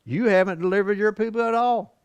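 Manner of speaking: high, pointed accusation; accusing God. Retold or imitated. Moses accusing God of failing, high and pointed. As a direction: throw the charge right at God. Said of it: "that's an accusation".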